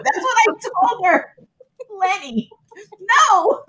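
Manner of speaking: high-pitched, laughing protest; protesting the nickname while laughing. Real, not acted. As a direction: squeal it through laughter.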